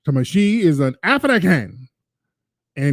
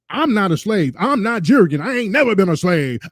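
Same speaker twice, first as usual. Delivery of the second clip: higher-pitched mock protest; mimicking the woman; 5 semitones higher than usual. Host mimicking the woman's denial, higher-pitched mock protest. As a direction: imitate an indignant woman, pitched up. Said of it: "She was like".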